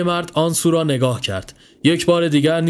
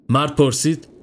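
ordinary delivery

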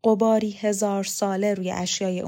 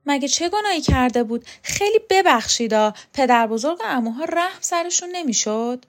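higher, louder affronted challenge; indignant questioning; 6 semitones higher, 4 dB louder than usual. A narrator's reading. Laqa challenges her mother about the grandfather's cruelty, indignant questioning. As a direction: question it in shocked protest.

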